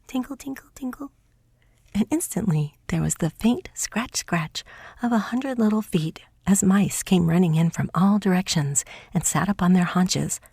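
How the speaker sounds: ordinary delivery, nothing marked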